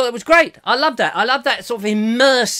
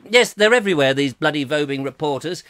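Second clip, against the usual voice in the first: normal talking voice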